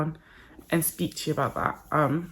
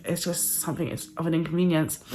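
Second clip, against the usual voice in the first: usual speaking voice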